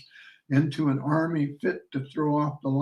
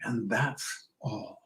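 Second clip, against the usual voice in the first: slow, much softer whispered aside; 3 semitones lower, 9 dB quieter, slower than usual. Acted. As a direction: breathe it under your breath, slow. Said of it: "Then I whispered".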